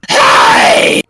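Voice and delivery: low, loud rasping growl; growled hey. Acted. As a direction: growl it at the thief. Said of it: "She kinda growls at you".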